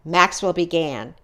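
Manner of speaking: plain reading voice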